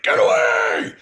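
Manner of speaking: high, loud mock shout of a panicked cop; mimicked cop yell. Imitating a freaked-out cop yelling at him. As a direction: bark it like a startled cop flashing his badge. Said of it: "freaked out on me".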